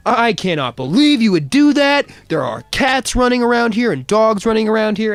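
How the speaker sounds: high, loud outraged scolding; angry scolding. Retold or imitated. Neighbor scolding the speeding driver, high loud outrage. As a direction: lecture the kid about the pets.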